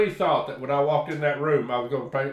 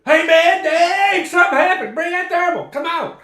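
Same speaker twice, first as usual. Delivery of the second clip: much higher and louder, urgent and excited; excited high shout; 10 dB louder than usual. Retold or imitated. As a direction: call out in a rush of alarm, high and loud. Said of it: "It's kinda like".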